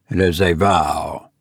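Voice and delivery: usual speaking voice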